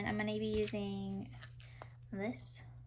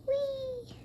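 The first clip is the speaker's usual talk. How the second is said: playful high squeal; 14 semitones higher than usual. Acted. Playful exclamation.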